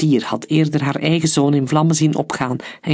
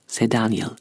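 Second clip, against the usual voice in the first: ordinary delivery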